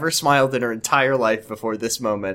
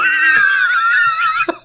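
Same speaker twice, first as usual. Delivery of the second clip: burst of shrieking laughter; 4 dB louder than usual. Real, not acted.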